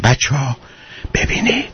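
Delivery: drops to a whisper on ببینید; hushing the noisy kids under your breath; father calming rowdy children with a whisper; quiet hush. Retold or imitated.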